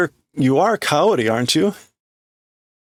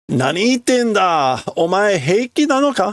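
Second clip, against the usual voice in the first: much higher, alarmed man; character voice; 8 semitones higher than usual. Acted. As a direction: ask in shock, in character. Voicing male character.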